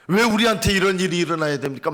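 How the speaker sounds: insistent outcry of blame; crying out. Acted. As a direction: blurt an aggrieved prayer. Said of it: "막 부르짖는데".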